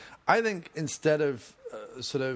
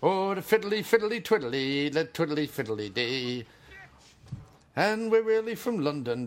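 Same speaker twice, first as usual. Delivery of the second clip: mock Irish folk lilt; 3 semitones higher than usual. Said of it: "They sound more Irish than you".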